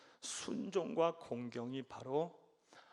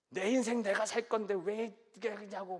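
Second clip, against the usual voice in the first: higher, defiant voice; rebellious son; 6 semitones higher, 4 dB louder than usual. Retold or imitated. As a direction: talk back like a sulky grown son. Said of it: "삼손의 입장에서 하반절을 읽으면". (부모에게 대드는 아들의 반항)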